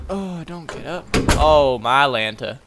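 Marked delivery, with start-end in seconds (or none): 0.1-1.1 soft, dropped don't get up; soft plea. Shown as a demonstration. Player quietly begging the character to stay in bed. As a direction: murmur it softly.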